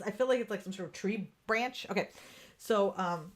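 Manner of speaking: ordinary talk, nothing marked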